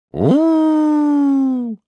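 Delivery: very high, long hooting "oo"; train hoot. A narrator's reading. Doctor playing trains hoots the arrival, imitating whistle. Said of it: "загудел снова доктор".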